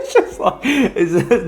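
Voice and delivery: breaking into airy laughter mid-sentence; words swallowed by a laugh; real laughter through the words. Real, not acted. Laughing at the kangaroo story.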